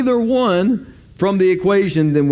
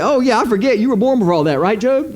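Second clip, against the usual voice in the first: higher, mocking I-forget tone; sarcastic God; 7 semitones higher than usual. God mocking Job with sarcasm, higher. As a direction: sneer it out, pretending to forget. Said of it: "He pulls out some sarcasm".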